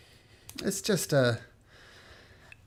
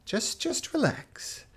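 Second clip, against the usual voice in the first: calming; 8 semitones higher than usual. Acted. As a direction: ease him down gently. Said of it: "He says".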